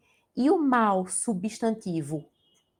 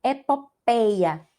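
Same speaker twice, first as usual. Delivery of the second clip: "Epopeia" said slowly and louder, every syllable clear; slow, careful; 3 semitones higher, 7 dB louder, much slower than usual. Shown as a demonstration. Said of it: "Veja que não tá acentuado".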